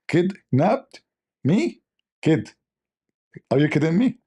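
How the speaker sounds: hammed-up astonishment; mock disbelief. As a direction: act outraged that anyone dared take you. Outraged disbelief at kidnappers.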